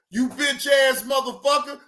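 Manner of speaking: usual speaking voice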